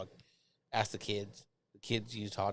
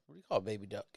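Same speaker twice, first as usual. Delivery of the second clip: ordinary talk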